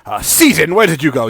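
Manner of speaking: fawning shopkeeper calling for his partner; merchant NPC voice. Acted.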